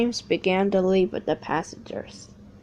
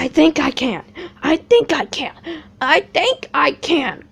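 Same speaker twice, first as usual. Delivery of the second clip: a touch of strain, louder; tired engine groaning up the hill; groaning James; 6 semitones higher, 8 dB louder than usual. Acted. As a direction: grind out each word uphill. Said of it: "groaned James".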